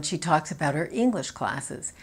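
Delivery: usual speaking voice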